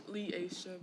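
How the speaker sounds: Leisha said lee-AY-suh, three syllables; correct name. Shown as a demonstration. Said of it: "No, sir, you did not".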